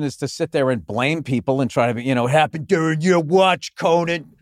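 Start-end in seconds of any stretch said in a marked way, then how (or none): 2.3-4.3 mock accuser. Mock blame at Conan.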